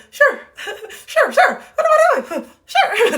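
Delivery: very high, loud, laughing put-on voice; hammed-up falsetto agreeableness breaking into laughs; forced okay act. Mocking herself answering a friend, forced okay act. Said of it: "I'm trying to act like it".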